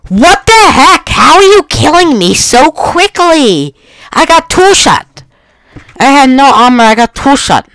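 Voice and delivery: high-pitched, loud gamer outburst; indignant high yelp; outraged yelling. Real, not acted. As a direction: shriek in disbelief at being killed. Killed quickly, protests in outrage.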